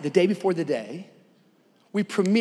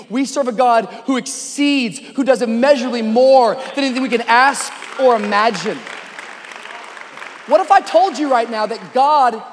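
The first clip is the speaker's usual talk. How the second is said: raised, driving exhortation; emphatic preaching; 5 semitones higher, 7 dB louder than usual. Real, not acted. Preacher encouraging someone about God's power, emphatic preaching. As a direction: build toward the big promise.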